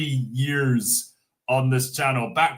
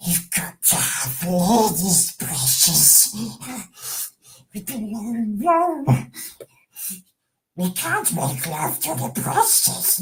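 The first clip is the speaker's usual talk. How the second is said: higher creepy hissing creature voice; Gollum voice; 8 semitones higher than usual. Acted. Gollum voice requested by chat, wheedling. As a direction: wheedle it like Gollum to his precious. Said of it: "say it in the Gollum voice".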